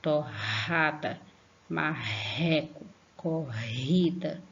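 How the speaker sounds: "torrada, marreco, corrida" slowly, with forceful h-like RR; slow dictation of "torrada, marreco, corrida", aspirated RR; strong R. Shown as a demonstration. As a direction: draw out each word and push breath into the RR. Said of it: "a sílaba fique forte".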